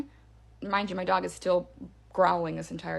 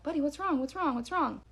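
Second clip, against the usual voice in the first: higher-pitched, mildly anxious acted question; retold worried questioning; 7 semitones higher than usual. Storyteller asks the growling dog, retold worry. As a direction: urgently check on a pet, lightly acted.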